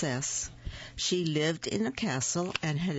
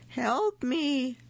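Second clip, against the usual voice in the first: calling for help; 9 semitones higher than usual. Acted. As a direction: cry out trapped in a tin can. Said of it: "he cried".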